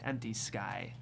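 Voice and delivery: usual speaking voice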